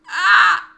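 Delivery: sudden loud high screech. Real, not acted. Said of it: "hearing me screech a lot more".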